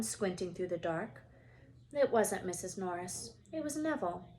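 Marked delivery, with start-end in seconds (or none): none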